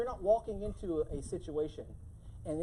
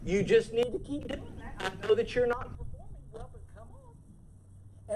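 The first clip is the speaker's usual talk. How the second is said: soft, sing-song coaxing of a boss; sugary performance review voice, softer; mock sweet soft scolding; 5 semitones higher than usual. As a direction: gently coax a slacking employee. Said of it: "And we talk like this".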